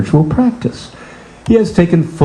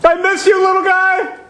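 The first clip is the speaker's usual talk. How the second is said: high, wailing self-pity; a high sob story; whiny wailing grief; 10 semitones higher than usual. Acted. [name] wails over daughter and dog.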